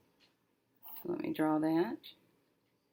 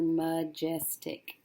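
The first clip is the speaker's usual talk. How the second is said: majestic sounded out slowly, syllables run together; slow, careful blend of majestic; slow blended word; much slower than usual. Shown as a demonstration. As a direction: stretch majestic out as you blend it. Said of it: "So put it together".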